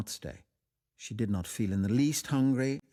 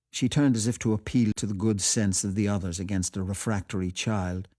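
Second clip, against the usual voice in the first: plain reading voice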